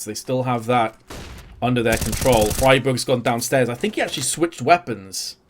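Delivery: energetic esports caster calling the action; live play-by-play. Acted. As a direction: call every duel as it happens.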